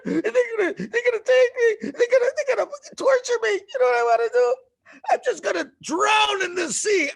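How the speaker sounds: very high, loud, crying, terrified wail; panicked wail. Acted. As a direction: sob out the fear, hammed up for the kids. Tribesman panics at the Egyptians.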